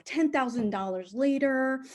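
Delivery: plain reading voice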